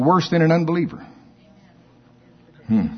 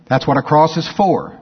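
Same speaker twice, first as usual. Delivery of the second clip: usual speaking voice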